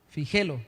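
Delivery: "fíjelo" said as fi-JE-lo; penultimate stress. Shown as a demonstration. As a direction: move the stress onto the middle syllable of "fíjelo". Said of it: "porque es grave".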